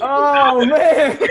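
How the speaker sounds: very high, loud "oh, man" breaking into laughter; shocked outcry. Real, not acted. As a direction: cry out in amused disbelief. Playful outcry after movie confession.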